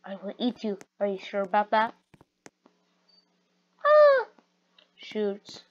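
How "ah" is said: high scream. Acted.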